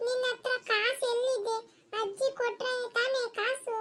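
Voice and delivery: put-on a boy taunting his mother about money. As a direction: jeer like a cheeky child.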